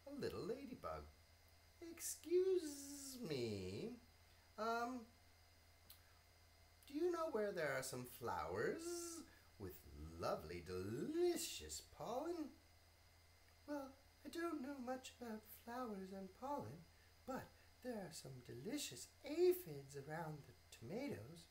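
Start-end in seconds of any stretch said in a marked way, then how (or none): 1.8-12.5 polite bee voice. Acted. Bee asks ladybug. 13.7-21.4 lightly voiced ladybug; friendly ladybug, a touch higher. Ladybug character in story.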